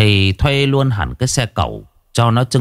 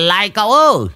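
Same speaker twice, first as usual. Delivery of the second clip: bellowing; faster than usual. Acted. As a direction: yell after him as he drives off. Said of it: "mà gào lên".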